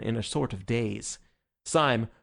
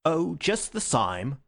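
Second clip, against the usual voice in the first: slow, higher mock Cockney; exquisite Cockney; 5 semitones higher, slower than usual. Syme mocking the Marquis in Cockney, slow and high. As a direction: lay on a playful Cockney, drawn out. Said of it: "in the most exquisite Cockney".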